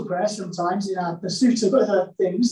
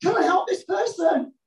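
mock prayer shout; 9 semitones higher than usual. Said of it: "you're shouting out".

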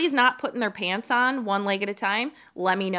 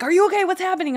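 higher, louder alarmed questions; mock Ryan rushing in alarmed; worried husband; 9 semitones higher, 5 dB louder, much faster than usual.

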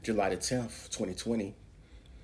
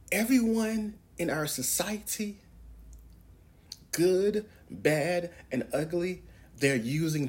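emphatic preaching; 8 semitones higher, 3 dB louder than usual. Real, not acted.

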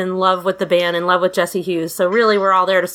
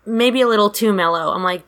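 usual speaking voice

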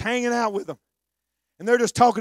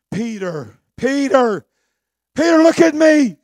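insistent call; 6 semitones higher, 7 dB louder, slower than usual. Acted. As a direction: raise the voice with each Peter. Jesus calls, rising.

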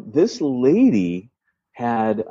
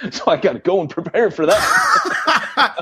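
laughing through the words, pitched up; 9 semitones higher, slower than usual. Real, not acted. Guest to host, laughing about the social worker visit. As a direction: chuckle it out at the joke.

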